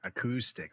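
low, soft "acoustic" as uh-KOO-stick; "acoustic" with coup in the middle; correct "acoustic". Shown as a demonstration. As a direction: confirm it, a-COO-stic. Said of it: "There you go".

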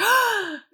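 quick high gasp; mock gasp. Kids seeing the neighbour come out, alarmed gasp. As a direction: gasp like a kid caught out.

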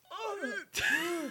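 loud, high groan of disgust. Real, not acted.

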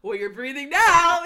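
loud, drawn-out motherly comeback; mock scolding retort. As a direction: imitate a mom shutting down her son, high and very loud. Retold mother cuts son's complaint off.

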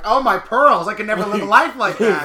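over-the-top mock horror; mock pearl-clutching. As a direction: play the shocked prude, hammed up.

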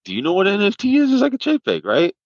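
asking his friend, imitating his own naive high voice; high innocent question. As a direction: act wide-eyed and clueless. Innocently asking friend about NFTs.

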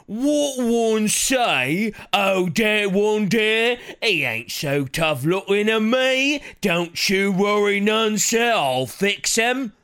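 loud, high-pitched, slow oafish British ogre; dumb British ogre. Acted. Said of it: "a dumb British ogre voice".

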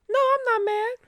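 jumps way up high and thin for the no; a sky-high mock-innocent denial; squeaky denial. Real, not acted. Comic denying to the audience she's mad, squeaky high.

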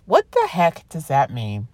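ordinary delivery

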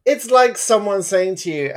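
normal talking voice

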